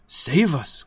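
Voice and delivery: usual speaking voice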